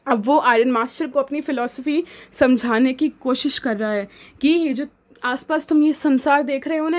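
usual speaking voice

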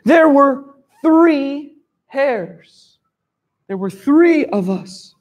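drawn out like a boastful storybook hare; king hare boasting. Acted. King hare lying to the lion, slow and proud. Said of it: "The king hare says to the lion".

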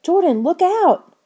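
high, loud, drawn-out warning; crying out. A narrator's reading. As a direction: cry out to warn a friend of danger. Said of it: "Lauren cried".

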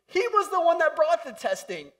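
high loud declaration; emphatic. Acted. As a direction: hit it hard from the pulpit. Emphatic preaching point.

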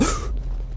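startled gasp. Real, not acted. Startled by a thing ahead.

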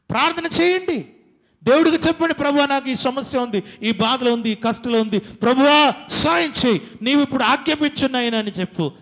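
high, loud fervent preaching cry; loud, high revival preaching; preaching at full force. Acted. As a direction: call the church to prayer with everything you have. Preacher calls out to congregation.

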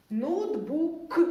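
ordinary talk, nothing marked

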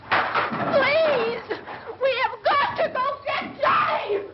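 high frantic begging shout; panicked cry for help; desperate pleading. Acted. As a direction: beg him to help, near hysteria. Begging to go rescue brother.

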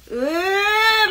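drawn-out "uuu", rising high; long hammed-up groan. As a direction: build a long, high mock-effort "ooh".

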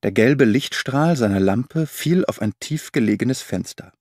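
usual speaking voice

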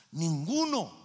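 forceful, full-voiced NINGUNO; emphatic answer. Real, not acted. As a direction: hit Ninguno hard with a falling finish. Emphatic answer, then softer repeat.

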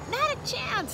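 high-pitched hawk refusal; scornful hawk. A narrator's reading. Hawk refuses to release Father.

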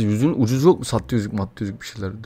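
normal talking voice

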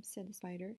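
ordinary delivery

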